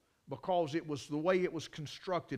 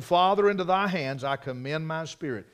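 raised, louder preacher's quotation; loud cry; 3 semitones higher, 7 dB louder than usual. Retold or imitated. Preacher quoting Jesus on the cross, loud cry. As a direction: project it as a dying cry, moderately loud. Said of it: "he cries with a loud voice".

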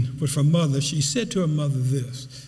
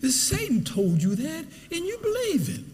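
mother's tender voice; 9 semitones higher than usual. Retold or imitated. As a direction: reassure her like a loving mother. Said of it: "She said".

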